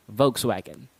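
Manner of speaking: "Volkswagen" as VOKES-wa-gen with a V; correct pronunciation. Shown as a demonstration. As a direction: model "Volkswagen" with V and a reduced last syllable. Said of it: "You pronounce that as Volkswagen".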